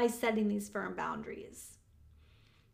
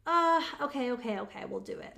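giving in. Acted. Coach models a parent relenting on a boundary, giving in. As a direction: cave in reluctantly, dropping away. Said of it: "it's more flexible. You could stretch it".

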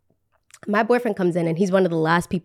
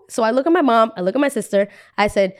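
ordinary talk, nothing marked

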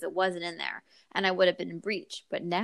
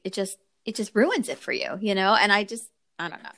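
ordinary talk, nothing marked

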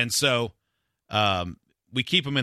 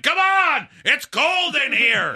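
much higher, louder mock yell, slow on the end; grumpy yell; 14 semitones higher, 7 dB louder, much slower than usual. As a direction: bark it like a cranky old customer. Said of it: "some old bastard at the counter yelling".

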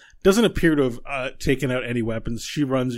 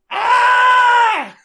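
piercing high scream, far higher and louder; pained scream; 15 dB louder than usual. Acted. Woman struck by whispers screams in pain, piercing. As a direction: clutch your head and shriek in agony. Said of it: "she grasps her head and she goes".

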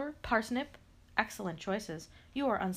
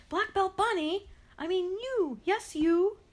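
calling out; 10 semitones higher, 6 dB louder than usual. Acted. Calling out to the bunny.

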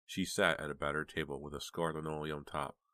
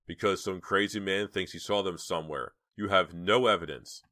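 angry; 3 semitones higher, 7 dB louder than usual. A narrator's reading. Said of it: "exploded Mister Martinson".

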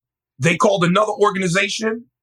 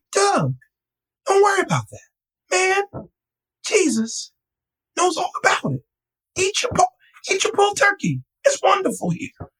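much higher, small-man voice for Marion; tiny little fella. Retold or imitated. Comforting the crying pastor over lunch, high little-man voice. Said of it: "he's a tiny little fella".

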